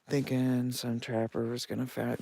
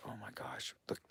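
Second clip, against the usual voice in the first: hushed alarm; 5 semitones lower, 16 dB quieter than usual. Retold or imitated. Shows partner the tracks.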